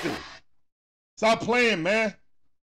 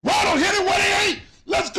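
excited shout; 8 semitones higher than usual. Real, not acted. Cheering a big hit on stream.

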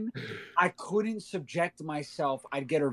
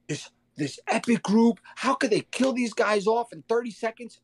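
mock outraged rant; 7 semitones higher than usual. Retelling his rant to wife.

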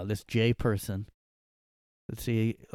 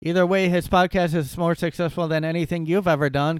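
raised, higher voice, defending hard; loud mock indignation; 6 semitones higher, 7 dB louder than usual. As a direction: read it like an offended fan. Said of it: "Jay responded".

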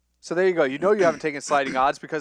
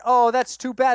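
higher, louder mock old lady sigh; imitating the old lady; 7 semitones higher, 5 dB louder than usual. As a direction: play the sweet old lady, lightly disappointed. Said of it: "And she went".

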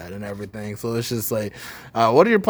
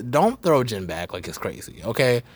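ordinary talk, nothing marked

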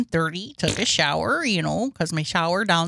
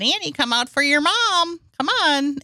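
higher coaxing call to a pet; calling a dog; 6 semitones higher than usual. Retold or imitated.

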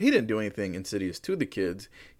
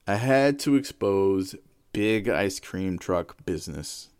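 usual speaking voice

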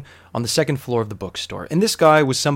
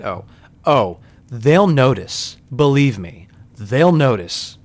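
louder, slow and drawn out, pressing each word; emphatic warning; 5 dB louder, much slower than usual. Retold or imitated. To the buyer about the Benadryl bottle, a slow, loud insistence. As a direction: hammer home that they will notice.

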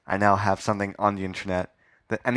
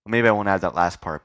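normal talking voice

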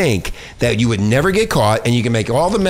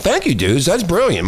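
usual speaking voice

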